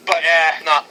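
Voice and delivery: ordinary talk, nothing marked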